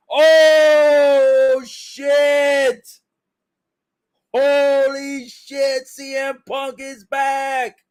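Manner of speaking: high, loud, stunned yell; shocked shout. Real, not acted. Fan yelling as CM Punk returns, shocked shout. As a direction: explode in disbelief at the return.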